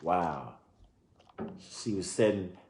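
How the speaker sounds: usual speaking voice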